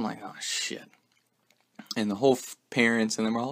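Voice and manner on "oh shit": breathy whisper of dread. Retold or imitated. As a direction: mutter it under your breath, caught out. Caught, reacting to himself.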